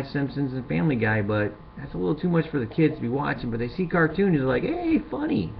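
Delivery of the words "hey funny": put-on high, childlike "Hey, funny"; mock kid delight. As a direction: chirp it like a delighted little kid. Kids reacting to cartoons.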